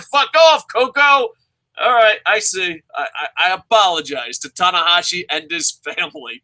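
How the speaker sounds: hammed-up wrestler telling off a fan; mock Tanahashi voice. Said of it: "And Tanahashi's like".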